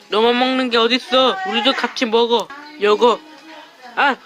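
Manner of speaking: ordinary talk, nothing marked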